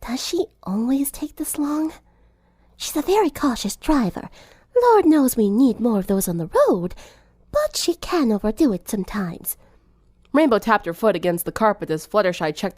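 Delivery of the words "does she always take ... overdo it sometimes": hushed aside between friends in a car. A narrator's reading. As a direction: lean in and keep it hushed. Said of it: "keeping her voice hushed".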